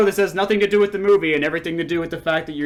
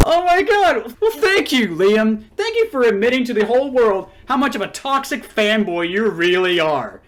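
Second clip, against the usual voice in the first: high, loud mocking gratitude; exaggerated, high-pitched sarcasm; sarcastic gushing thanks; 6 semitones higher, 4 dB louder than usual. Real, not acted. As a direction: thank them as if barely over laughing. Sarcastic thanks after mocking laughter.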